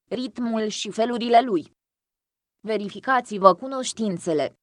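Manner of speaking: ordinary delivery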